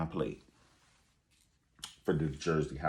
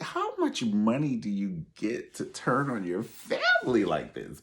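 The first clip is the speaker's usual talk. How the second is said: ordinary talk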